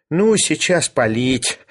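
slow, dismayed exclamation; drawn-out mild despair. A narrator's reading. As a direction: protest as if all is lost. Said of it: "отчаянно воскликнул Шариков".

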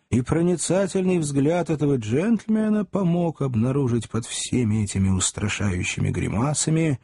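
plain reading voice

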